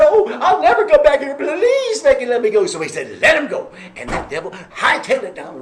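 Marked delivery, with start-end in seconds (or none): 0.0-2.7 high, loud desperate begging; a trapped little devil howling for release; pleading yell. Acted. 3.2-3.7 loud, high order; John telling the hammer to let go; sharp command. Acted.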